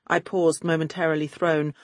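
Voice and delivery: usual speaking voice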